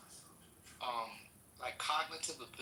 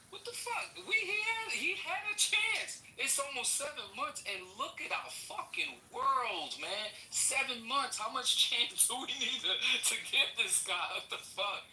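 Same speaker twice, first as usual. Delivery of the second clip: much higher, heated and incredulous; exasperated outburst; 8 semitones higher than usual. Real, not acted. Speaker venting at the room about seven wasted months, exasperated. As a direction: let the outrage climb in pitch. Furious rant about giving Trump chances.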